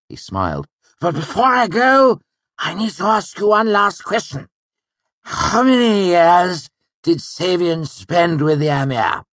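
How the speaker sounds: high excited nobleman; bright, laughing older count; Count Threep voice. A narrator's reading.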